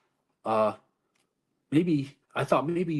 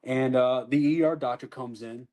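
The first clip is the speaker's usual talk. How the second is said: ordinary talk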